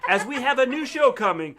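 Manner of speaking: loud, laughing trailer announcer; mock TV promo. Announcing a fake sitcom to stream viewers, in a loud, laughing promo voice. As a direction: hype it up like a sitcom ad.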